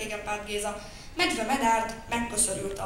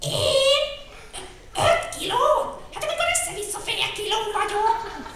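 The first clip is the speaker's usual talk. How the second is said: much higher, shrieking little crow voice; outraged crow; 13 semitones higher, 8 dB louder than usual. Acted. Crow protests she weighs half a kilo, high squawk.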